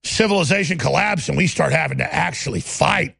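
ordinary talk

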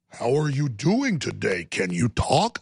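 patronizing, drawn-out parody; mock condescension. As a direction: speak as if the listener were an idiot.

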